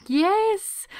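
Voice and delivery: a high, loud, gleeful yes; excited squeal. Retold or imitated. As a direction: squeal it like a giddy fan. Retold gleeful answer to husband.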